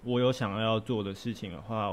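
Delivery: ordinary talk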